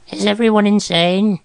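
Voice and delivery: high, strained croak; acted high croak of outrage. A narrator's reading. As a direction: croak it out in disbelief. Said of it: "Groverbs croaked".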